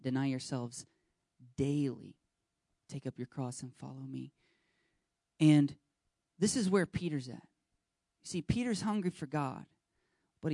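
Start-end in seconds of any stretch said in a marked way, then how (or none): 0.0-4.3 quiet quote. Retold or imitated. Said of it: "I think Jesus said something about that".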